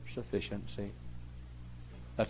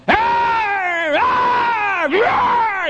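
long high screamed hollers; hollering preacher; 12 dB louder, much slower than usual. Retold or imitated. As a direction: imitate wild pulpit screaming at full force. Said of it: "I was hollering and screaming".

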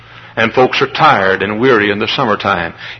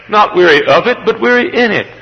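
high ringing preacher's cry; full-force preaching; 10 semitones higher than usual. Acted. Preacher to his congregation, full-force preaching. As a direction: lift it up like a revival preacher.

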